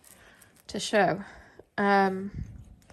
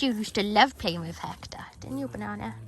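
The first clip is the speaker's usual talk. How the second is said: whispering. Real, not acted. Softly cooing to dog.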